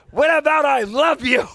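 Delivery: high, loud comic protest; indignant shout. Retold or imitated. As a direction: yell it as a hurt, mock-outraged son. Son protests at father's last words.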